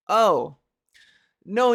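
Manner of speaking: ordinary talk, nothing marked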